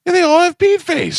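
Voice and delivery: high, loud mocking verdict; mock teasing. Teasing wife about the Bumble guys, high and loud. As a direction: sneer at the guys' photos. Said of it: "I go".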